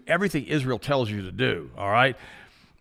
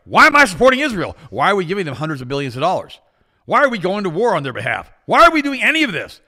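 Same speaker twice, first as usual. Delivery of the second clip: mock outraged supporter; 7 semitones higher, 8 dB louder than usual. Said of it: "And then they say".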